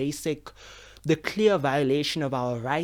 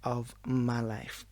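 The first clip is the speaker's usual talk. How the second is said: wry resignation; 4 semitones lower, 4 dB quieter, much slower than usual. Real, not acted. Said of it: "It's a mess".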